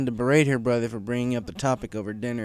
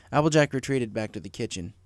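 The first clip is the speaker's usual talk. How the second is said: ordinary delivery, nothing marked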